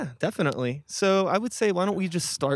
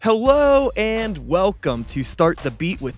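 slow, drawn-out show opening; podcast intro; 3 semitones higher, 3 dB louder, much slower than usual. Real, not acted. Host welcomes listeners to the podcast, drawn-out intro voice. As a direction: announce the show slowly, pitch raised. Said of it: "I'm gonna do my intro".

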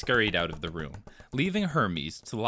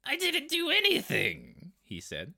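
very high, slow stunned voice; drawn-out dazed high squeak; Ratrick in shock; slower than usual. A narrator's reading. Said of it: "in numb shock".

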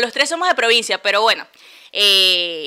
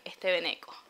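"Este veneco" said lower and softer with contempt; contemptuous veneco; 3 semitones lower, 12 dB quieter than usual. Shown as a demonstration. Showing that the tone changes the word, contemptuous. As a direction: spit out "veneco" as a put-down. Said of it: "no es lo mismo".

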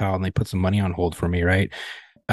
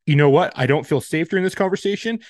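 higher-pitched mimicry of an offended phone agent; mock offended agent; 6 semitones higher than usual. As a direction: imitate a fretful agent in a raised pitch. Retells agent about to hang up.

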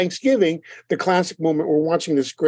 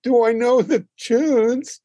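slow, higher, drawn-out "Do I know the tunes?" with "know" stretched; incredulous scoff; 7 semitones higher, slower than usual. Retold or imitated. Friend mocking the question about knowing the songs, slow incredulous drawl. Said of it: "He goes".

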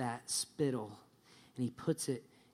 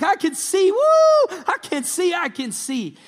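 high, shouted jubilation with a whoop; all excited; 12 dB louder than usual. Acted. Preacher as the man who got his sight, excited. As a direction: whoop and cry out, thrilled. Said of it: "he's all excited. He's like".

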